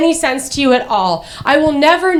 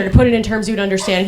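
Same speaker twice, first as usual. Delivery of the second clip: usual speaking voice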